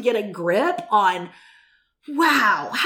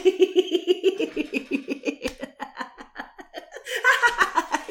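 higher, free-flowing laugh out of the fake one; real laugh; 8 semitones higher than usual. Shown as a demonstration. Preacher showing fake laughter turning real, higher genuine laugh. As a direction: let the forced laugh tip into a real one. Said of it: "pretty soon you're gonna real laugh".

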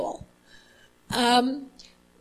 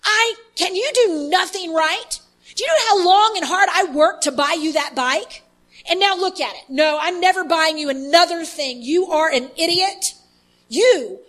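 higher, louder furious father yelling at his son; screaming father; 6 semitones higher, 6 dB louder than usual. Retold or imitated. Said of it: "his father starts screaming at him".

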